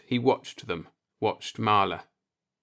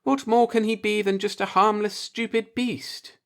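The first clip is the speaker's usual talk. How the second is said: lightly voiced woman, higher; lightly raised woman's voice wondering aloud; Marla's voice; 10 semitones higher than usual. A narrator's reading.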